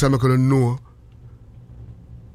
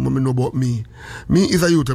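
ordinary talk

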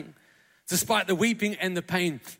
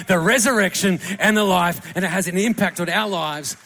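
rousing, emphatic sermon climax; full-force preaching; 5 dB louder than usual. Real, not acted. Preacher urging every one of us to know the power, forceful and building.

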